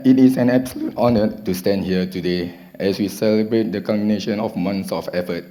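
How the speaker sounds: ordinary talk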